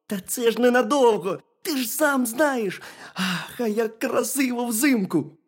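high-pitched hedgehog consoling a friend; reassuring hedgehog. A narrator's reading. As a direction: cheer up the bear in a high voice. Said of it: "Та заспокойся".

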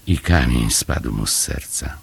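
ordinary delivery

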